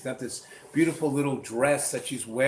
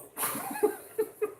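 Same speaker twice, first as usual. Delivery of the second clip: high laugh; 3 dB quieter than usual. Real, not acted. Laugh after gun reveal.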